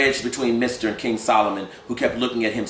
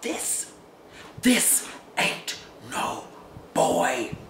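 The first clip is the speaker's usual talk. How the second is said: slow hushed denial; 9 semitones higher, much slower than usual. Acted. Gus denies the child is boy.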